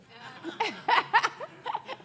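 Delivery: loud high laugh at her own joke; real high laugh; high burst of laughter. Real, not acted.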